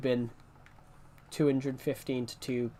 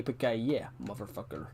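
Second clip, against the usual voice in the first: movie villain quote; 3 semitones lower, 6 dB quieter than usual. Retold or imitated. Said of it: "What was it you said to me?".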